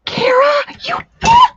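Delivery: high, loud, overjoyed cry; thrilled squeal. Real, not acted. Streamer squealing thanks to a viewer for a gift. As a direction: squeal with real delight at a gift.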